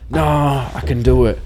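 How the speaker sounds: hammed-up drunk pleading; drunk protest. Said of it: "I'm just like".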